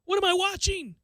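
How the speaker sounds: high, baffled what-am-I-seeing; mock stunned fan; bewildered disbelief. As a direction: voice jumps up in confusion. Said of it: "You're like".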